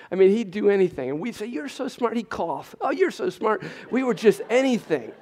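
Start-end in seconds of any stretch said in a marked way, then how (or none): 1.5-2.2 doting praise. Retold or imitated. Parents praising son. 2.8-3.6 put-on high, loud, over-the-top praise for a little boy; gushing praise. Parents praising son at anything.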